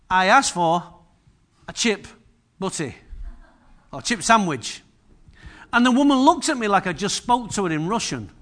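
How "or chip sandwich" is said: usual speaking voice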